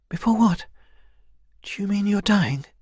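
high-pitched, concerned questioning; worried young heroine. Acted. Kai questions the elder about dying, worried. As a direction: ask with dawning alarm, pitched right up.

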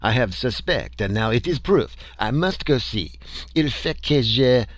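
a Frenchman who can't wait to go; lightly voiced French excitement; excited French accent. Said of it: "shouted Leroy".

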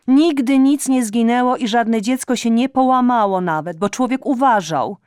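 high, loud reproachful tirade; scolding mother. Acted. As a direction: nag her like an aggrieved mother. (matka z pretensją podniesionym głosem)

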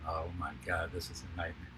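hushed, horrified under the breath; quiet dismay. Retold or imitated. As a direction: mutter it in quiet dread. Crew dreading the shiny suit.

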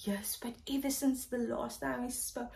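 whispered yes, but ever since; hushed confession. Retold or imitated. Voicing the man's secret admission, soft whisper. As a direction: breathe it out in a whisper.

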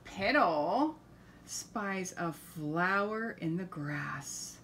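usual speaking voice

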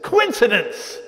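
high, loud voice of the sceptical mind; mocking shout. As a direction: blurt it out like a scoffing inner voice. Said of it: "my mind said".

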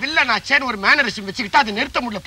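ordinary talk

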